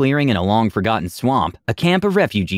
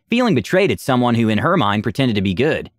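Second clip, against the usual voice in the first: ordinary talk, nothing marked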